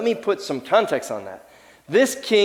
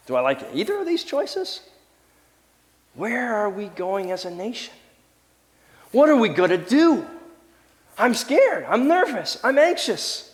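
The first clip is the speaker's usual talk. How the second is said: rising, higher pitched anxious pleading; anxious worry; 5 semitones higher than usual. Acted. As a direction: voice climbing with worry about the nation. Worried voter questioning leaders.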